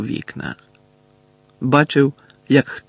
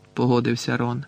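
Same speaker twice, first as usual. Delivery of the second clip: ordinary delivery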